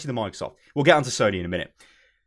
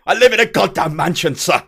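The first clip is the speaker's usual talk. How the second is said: loud, hammed-up Victorian English boasting; indignant ye olde English toff, slowing grandly; Victorian English gentleman; 6 dB louder than usual. Customer boasting of his mansion and land, loud Victorian English gentleman. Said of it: "a ye olde English Victorian-y style".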